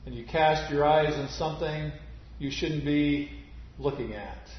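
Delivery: ordinary delivery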